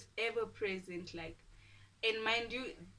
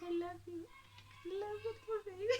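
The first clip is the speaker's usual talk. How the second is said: high, squeaky mock sweet talk; gushing lovey-dovey; 12 semitones higher, 3 dB quieter, faster than usual. Couple making up after the kiss, gushing lovey-dovey. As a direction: coo it way up high, hammed-up.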